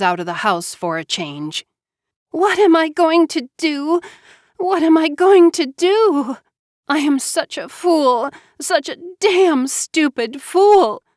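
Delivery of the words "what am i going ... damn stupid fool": high, tearful self-reproach; desperate sobbing. A narrator's reading. High tearful despair, near sobbing. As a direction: Joanna alone and pregnant, despairing.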